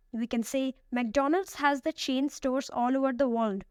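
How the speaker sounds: normal talking voice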